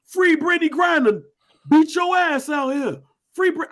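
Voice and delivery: mock protester yelling at a Moscow cop; mock protester shout. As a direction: play a cocky protester yelling at police. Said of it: "Let me get up in this plane".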